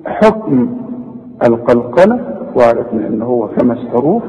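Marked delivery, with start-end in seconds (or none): none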